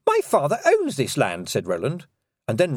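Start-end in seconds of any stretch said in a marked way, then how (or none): 0.1-1.5 put-on haughty young man. Roland blurts a threat.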